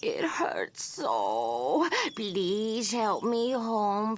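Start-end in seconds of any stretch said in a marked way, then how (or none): 0.0-1.9 whimpering. Acted. Witch whimpers about ankle.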